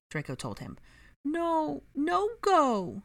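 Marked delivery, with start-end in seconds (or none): 1.2-3.0 put-on high child's voice, insistent; a small boy refusing to let go; toddler protesting. A narrator's reading. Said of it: "he protested".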